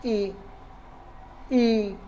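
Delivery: ई held out clearly, as a vowel to copy; palatal vowel demo. Shown as a demonstration. Teacher sounding out ई for students to repeat, clearly. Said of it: "जैसे आप उच्चारण करके भी देख सकते हैं".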